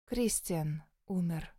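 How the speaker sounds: slow, soft, mournful announcement; tragic tone. A narrator's reading. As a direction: deliver the news like a tragedy. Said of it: "трагическим тоном сообщила она".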